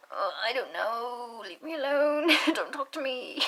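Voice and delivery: slow, drawn-out comic whine; mock whining. Speaker to nosy questioners, mock whining. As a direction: plead to be left alone, hammed-up. Acting out being pestered by questions.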